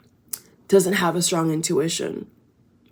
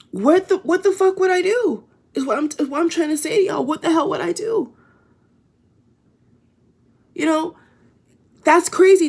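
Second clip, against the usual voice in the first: much higher, louder, near-shrieking disbelief; rising panic; 10 semitones higher, 4 dB louder than usual. Real, not acted. To her viewers, panicking at the thought of having kids, pitched way up. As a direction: voice jumps up high in panic. Freaking out over imagined kids.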